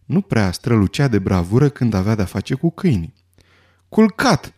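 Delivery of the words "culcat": high, sharp shout to a dog; yelled command. A narrator's reading. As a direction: yell the order in a raised pitch. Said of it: "zbieră Ferenside apucând biciul". (comandă zbierată pe ton înalt)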